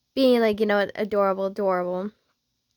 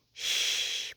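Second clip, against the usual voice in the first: long soft shush; quiet them with a slow shush; hushing shh; 8 dB quieter than usual. Acted. Hushing the flustered listener.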